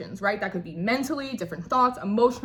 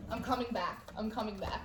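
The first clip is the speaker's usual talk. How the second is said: much softer mutter to herself; quiet aside; 13 dB quieter than usual. Real, not acted. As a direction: drop to a murmur, pulling herself together. Mutters after gushing.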